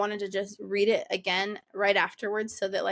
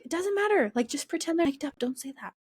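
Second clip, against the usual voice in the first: coaxing; 7 semitones higher than usual. Retold or imitated. Bride coaxing groom to forget the microphones, higher coaxing. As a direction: wave the worry away lightly. Telling husband to ignore mics.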